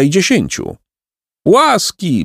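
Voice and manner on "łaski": high, pleading cry; theatrical plea for mercy; begging. A narrator's reading. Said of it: "błagał Jacek".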